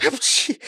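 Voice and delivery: high "apchi" sneeze; acted sneeze. A narrator's reading. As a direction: sneeze in a squeaky character voice. Character's recurring sneeze.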